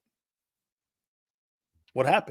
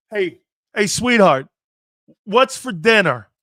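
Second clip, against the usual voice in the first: loud, higher mock host calling out to a caller; imitating radio host; 4 semitones higher, 9 dB louder than usual. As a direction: put on a pushy host demanding dinner. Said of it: "He's on the air going".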